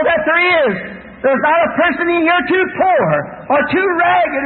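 high-pitched, impassioned sermon delivery; real revival-preacher fervor; fervent preaching. Real, not acted.

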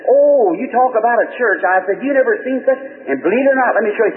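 high, enthusiastic retelling; happy excitement. Retold or imitated. Husband raves to his wife about a church, excited. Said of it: "You look so happy".

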